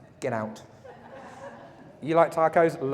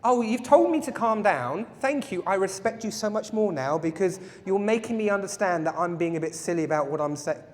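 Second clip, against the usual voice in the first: sarcastic gratitude; 3 semitones higher than usual. Pretend. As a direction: lay on the mock gratitude thickly. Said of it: "to somebody and it worked?".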